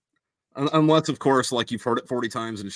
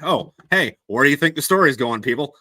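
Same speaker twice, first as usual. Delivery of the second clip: knowing nudge; 4 semitones higher, 8 dB louder than usual. Acted. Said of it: "And it's like".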